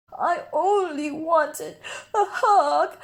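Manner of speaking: slow sniffling sad plea; sulky sniffle; sniffly. Acted. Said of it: "sniffed Douglas".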